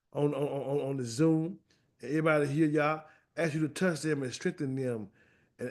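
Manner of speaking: prayer. Acted. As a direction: pray quietly over the congregation. Closing prayer.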